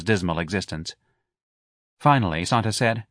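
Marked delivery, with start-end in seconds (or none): none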